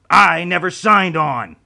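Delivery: loud, slow, indignant snap; angry snap. A narrator's reading. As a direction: bite the words out in anger. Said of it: "Remo snapped".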